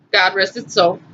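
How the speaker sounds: normal talking voice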